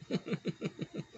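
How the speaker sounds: a breathy giggle held back; snickering at someone's misfortune; stifled chuckle. Real, not acted. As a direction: let a guilty laugh slip out. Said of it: "I shouldn't be laughing".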